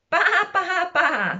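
high, bleating sheep noise; hen pretending to baa. A narrator's reading. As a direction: bleat it out, starting high and coming down. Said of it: "said the fat red hen".

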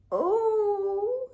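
long, high moaned "oh". A narrator's reading. Narrator voices overfed Hetty sitting down, moaning. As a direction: moan on one drawn-out high note. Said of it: "she moaned".

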